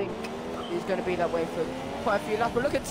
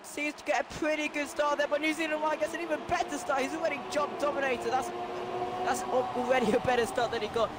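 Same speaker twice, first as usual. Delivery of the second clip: raised, driving race call; motorsport commentator; 6 semitones higher, 7 dB louder than usual. Real, not acted. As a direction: call the race live, building energy. Live race play-by-play.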